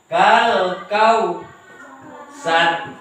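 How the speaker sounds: "Kal kau sar" with a stop after "kau"; closed syllables. Shown as a demonstration. Teacher shows the silent-vowel sign, "kau" closed before "sar". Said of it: "harus dimatikan".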